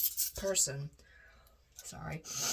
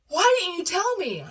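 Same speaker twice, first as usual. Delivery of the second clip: upset mom; 12 semitones higher, 9 dB louder than usual. Retold or imitated.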